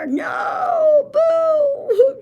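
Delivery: high, loud wailing sob; crying wail. Acted. Cheese who lost wails at the result, crying loudly. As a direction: wail it like a sore loser. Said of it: "He's crying".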